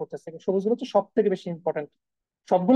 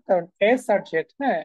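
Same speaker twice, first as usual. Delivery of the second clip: normal talking voice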